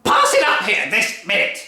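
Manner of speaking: bully the whole family; furious Grandma. Acted. Grandma demanding her breakfast, loud bullying bellow.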